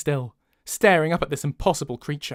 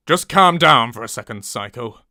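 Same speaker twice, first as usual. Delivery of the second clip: loud growled order, dropping at the end; forceful, falling command; 6 semitones lower, 4 dB louder than usual. A narrator's reading. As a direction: growl it as a northern character. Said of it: "he growls".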